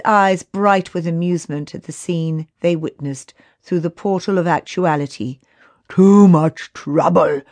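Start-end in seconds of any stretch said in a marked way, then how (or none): 5.9-7.4 slow, louder, scornful; spat contemptuously. A narrator's reading. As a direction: spit the words out. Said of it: "spat Lockian".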